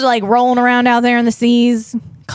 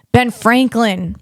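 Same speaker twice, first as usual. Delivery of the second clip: ordinary delivery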